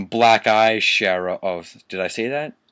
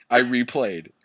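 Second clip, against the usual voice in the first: usual speaking voice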